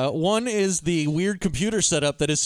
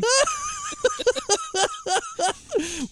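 high, squealing laugh; real burst of laughter. Real, not acted. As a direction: let a big real laugh break out.

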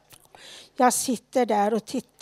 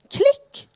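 a sharp high "klick", said much higher and louder; high door click sound; 4 dB louder than usual. Retold or imitated. Telling how her door closed, a high imitated click. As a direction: snap out the click of the lock.

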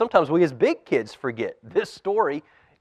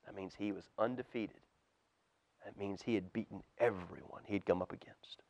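put-on soft whisper to the kids; storyteller whisper; whispered explanation; 6 semitones lower, 18 dB quieter than usual. A narrator's reading. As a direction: hush it like a secret.

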